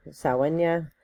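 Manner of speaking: Samhain pronounced SAH-en-ya; alternative pronunciation. Shown as a demonstration. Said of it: "which can also be pronounced".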